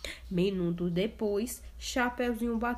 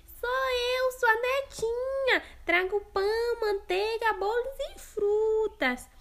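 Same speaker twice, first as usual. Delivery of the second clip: much higher sugary fake girl voice; wolf faking a sweet voice; 13 semitones higher than usual. Wolf imitating the girl at grandma's door. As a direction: pretend to be the granddaughter. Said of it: "O lobo fez uma voz doce".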